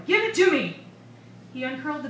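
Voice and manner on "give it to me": high, loud demand; yelling. A narrator's reading. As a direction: yell like a child wanting it back. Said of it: "yelled the boy".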